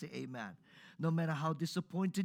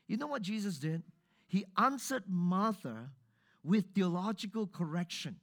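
normal talking voice

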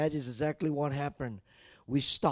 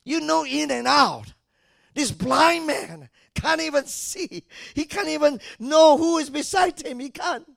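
high, loud pulpit storytelling; preacher telling of the blind man, full-force preaching; 12 semitones higher, 10 dB louder than usual. Acted. Build loudly towards his cry.

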